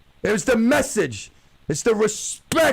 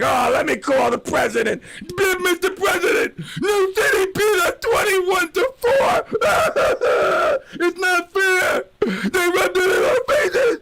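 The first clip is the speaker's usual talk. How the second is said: mock crying; 9 semitones higher than usual. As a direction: wail it like a sore loser tattling to the president. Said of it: "the crying".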